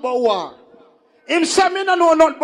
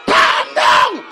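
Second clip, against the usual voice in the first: yelled much higher and louder, slow and drawn; shouting; 13 semitones higher, 5 dB louder, slower than usual. Real, not acted. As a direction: bellow over the crowd to calm them. MC shouting down crowd.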